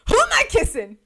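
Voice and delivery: high, loud baffled question; a groomsman lost at the kiss cue; mock baffled. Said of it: "one of the groomsmen goes".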